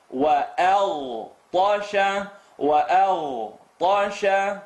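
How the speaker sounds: wa-al-tasha very slow, waw light, alif kept; light waw, full alif. Shown as a demonstration. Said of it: "Please don't do that".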